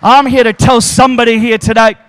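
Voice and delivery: loud, high climactic sermon shout; preacher building the church into a loud, high climax; full force preaching. Acted. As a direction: preach it to the rafters.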